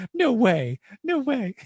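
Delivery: high, loud "no way" in delighted shock; giddy thrilled passenger; squealing disbelief. Retold or imitated. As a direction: squeal it like she can't believe it. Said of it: "She's like".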